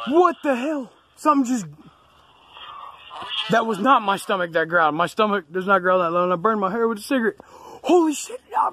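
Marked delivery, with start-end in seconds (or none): 0.1-1.7 shocked. Real, not acted. Hears a strange growl. 7.5-8.7 high shocked exclamation; jumping up in pitch in alarm; real shock. Real, not acted. Hears a strange growl.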